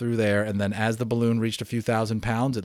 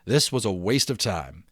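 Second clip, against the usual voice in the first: lively read-aloud narration, a bit louder than the chat; story narration; 4 semitones higher, 6 dB louder than usual. A narrator's reading. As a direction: read the adventure to the class with energy. Teacher reads novel aloud.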